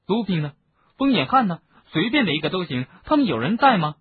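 high, loud searching calls; desperate calling. Acted. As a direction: call for anyone at all.

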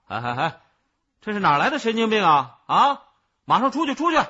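观众愤怒地高声轰人; 又高又响地呵斥; 大声叫嚷. A narrator's reading. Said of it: "便大声叫了起来". (扯着嗓门往外赶人)

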